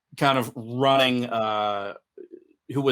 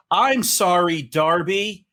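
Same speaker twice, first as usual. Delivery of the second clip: louder, higher in-character apology; apologetic creature; 5 semitones higher, 5 dB louder than usual. Acted. Creature apologizing to the teammate who soothed it, raised apology. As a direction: say sorry as the doomed creature.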